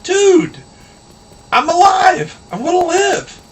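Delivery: very high, loud, elated squeal of a voice; hammed-up high-pitched jubilation; overjoyed bird. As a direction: cry out with relief as a little cartoon bird. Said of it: "And he's all happy".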